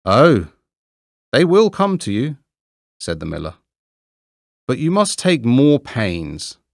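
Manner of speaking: the miller talking down to Hans, lightly voiced; lightly voiced condescending miller. A narrator's reading. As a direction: reassure him from above.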